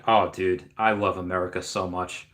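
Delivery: ordinary delivery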